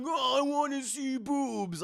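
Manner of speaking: high, slow put-on whine; mock upset viewer. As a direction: draw it out high, imitating a let-down fan. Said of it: "they're very upset".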